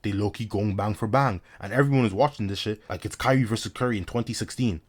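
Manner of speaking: ordinary delivery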